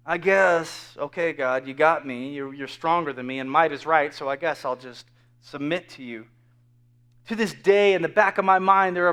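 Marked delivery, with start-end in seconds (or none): 0.1-6.3 low, subdued, grudging concession; mock-defeated submission, sinking softer; resigned surrender. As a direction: give in reluctantly, voice dropping. Resigned surrender to God, acted.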